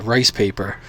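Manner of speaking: usual speaking voice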